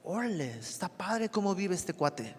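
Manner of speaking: hushed, impressed onlooker voice, a touch put on; lightly voiced impressed outsider; admiring outsider. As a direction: quietly marvel at someone's life like a neighbor.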